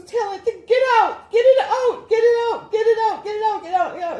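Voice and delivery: high, loud imitation of a panicked shriek; reenacted panic at full pitch; freaking out. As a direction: act out her frantic screaming, repeating it urgently. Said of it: "she's freaking out".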